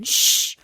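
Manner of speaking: unvoiced shush; hushing shush. Mother shushes her son in front of the guest, whispered shh. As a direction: hush the boy quickly.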